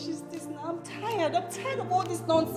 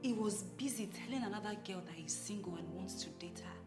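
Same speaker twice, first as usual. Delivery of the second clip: quiet despair; 8 semitones lower, 13 dB quieter than usual. Acted. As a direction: murmur it to yourself, heartbroken. Alone, despairing over her marriage.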